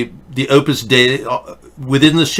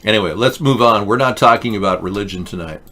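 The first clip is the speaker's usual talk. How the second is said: ordinary delivery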